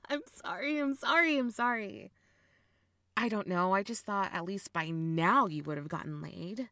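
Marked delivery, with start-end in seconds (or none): 0.1-2.1 higher, giggly repeated sorry; amused, breaking into laughter; laughing apology. Acted. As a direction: apologise while failing to stop laughing. Apologising while laughing at brother.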